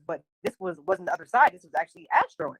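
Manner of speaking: ordinary talk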